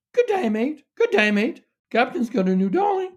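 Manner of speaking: very high squawking parrot voice. A narrator's reading. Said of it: "squawked the bird".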